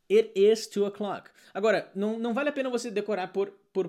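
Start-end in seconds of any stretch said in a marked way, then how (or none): 0.1-1.3 louder, slow It is two o'clock; emphatic model. Shown as a demonstration. Teacher models telling the time, louder and slow. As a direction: stress the it firmly. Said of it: "Horário".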